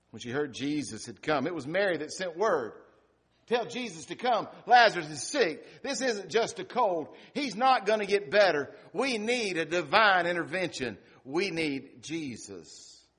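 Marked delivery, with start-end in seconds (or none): 3.5-13.1 voicing Mary's urgent message, a bit louder and higher; urgent plea. Sister sending word to Jesus, urgent plea. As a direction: plead for help as the anxious sister.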